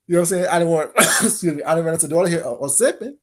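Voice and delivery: ordinary talk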